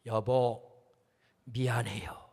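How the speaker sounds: low, soft apology to his wife; tender apology. Retold or imitated. Husband softly apologising to his wife, low and gentle. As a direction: say sorry gently and humbly. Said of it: "라고 말했습니다".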